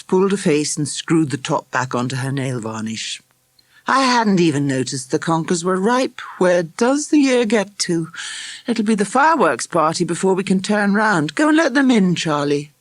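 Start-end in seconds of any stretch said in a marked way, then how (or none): 3.9-12.7 lightly voiced mother, higher and lively; a hint of a mildly surprised mum; chatty higher-pitched mother, then sending her son off; busy mother. A narrator's reading.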